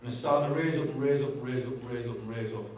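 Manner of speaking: normal talking voice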